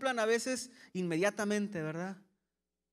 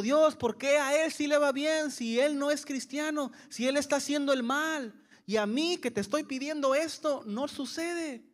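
pitched up, louder mock complaint; complaining to God; 5 semitones higher, 4 dB louder than usual. Believer complaining to God, higher and louder. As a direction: protest the unfairness. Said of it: "tú estás diciendo".

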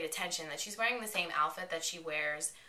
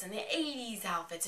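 ordinary talk, nothing marked

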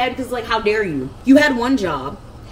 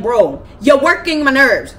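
louder, slower, fed-up warning; exasperated; 5 semitones higher, 8 dB louder, slower than usual. Retold or imitated. To her brother who skipped packing, fed-up and louder. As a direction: drag it out, done with him. Retold fight with brother over move.

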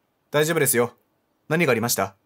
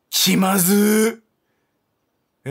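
long drawn-out, louder cringe; cringing at the awkward moment; awkward groan; 6 semitones higher, 6 dB louder, much slower than usual. Real, not acted. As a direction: stretch it out in embarrassment. Reacting to awkward rejection.

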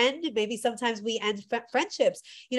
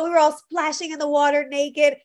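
ordinary talk

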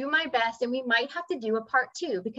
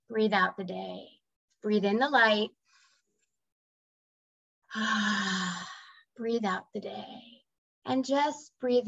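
guided meditation. Acted. Teacher guiding her students through breathing, calm meditation voice. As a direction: breathe them into calm, drawing out each breath cue.

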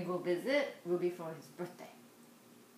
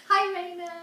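high, louder, beaming hello; delighted greeting; 11 semitones higher, 8 dB louder than usual. Real, not acted. Mom thrilled toddler greeted friend, high excited hello. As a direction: cheer him on with a bright hi.

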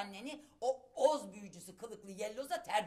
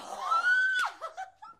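shocked gasp; 9 dB louder than usual. Acted. Offended mother reacting.

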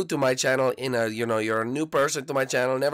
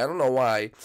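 ordinary delivery

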